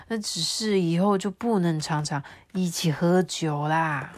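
put-on a friendly ghost telling his old friend the news; kind water ghost. 水鬼对渔夫说话. (讲故事的水鬼角色声)

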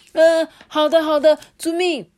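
high, loud hasty yes; obey in a fluster; flustered cook. Acted. Cook hurriedly obeying the head chef.

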